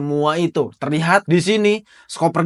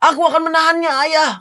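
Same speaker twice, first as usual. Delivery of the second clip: put-on high, straining child's promise; a boy forcing himself to be strong; brave child; 13 semitones higher, 4 dB louder, faster than usual. Son promising his father he will endure, high and strained.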